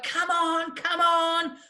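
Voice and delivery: high, drawn-out mock call; urging instructor. Instructor on the wing to student, urging her on. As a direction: imitate an instructor calling someone on.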